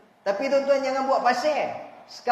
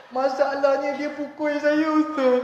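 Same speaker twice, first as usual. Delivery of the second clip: higher, louder plaintive complaint; hammed-up beaten husband; 5 semitones higher, 5 dB louder than usual. Husband telling the ustaz his wife hits him, plaintive. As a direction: confess it like a wronged man.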